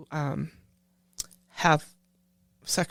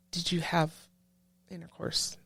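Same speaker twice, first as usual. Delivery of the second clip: softer, hushed doctor's question; quiet leaning-in question; 9 dB quieter than usual. Retold or imitated. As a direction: lean in and ask it quietly. Doctor leans down, asks her.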